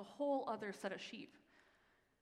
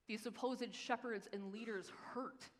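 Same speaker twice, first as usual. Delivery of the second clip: ordinary delivery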